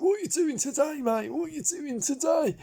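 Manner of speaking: high-pitched, badly imitated presenter accent; Lloyd Grossman's accent. Presenter asks what contestant is doing today, badly imitated accent. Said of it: "I can't do his accent".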